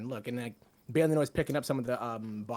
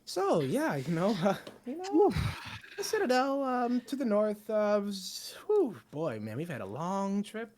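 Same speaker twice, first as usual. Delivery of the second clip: high bard voice; 6 semitones higher than usual. Acted. Bard voice asking about citadel.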